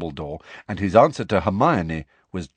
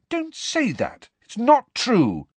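put-on upset girl protesting; 3 dB louder, slower than usual. Hermione protests.

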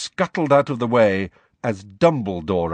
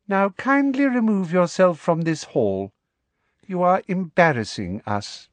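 somewhat higher, prim and dismissive; a hint of sugary contempt; lightly voiced Umbridge; 7 semitones higher than usual. Inquisitor ordering the weeping teacher out, prim and dismissive.

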